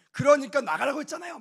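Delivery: loud, high mock shouting; imagined angry yell. Father throws the pastor out, hammed-up yell.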